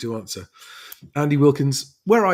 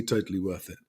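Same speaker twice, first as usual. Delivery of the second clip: "totally worth it" said softly and lower, trailing off; quiet echo; 4 semitones lower, 8 dB quieter than usual. Real, not acted. Vlogger repeating the verdict to himself, quiet and low. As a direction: drop "totally worth it" to a soft aside.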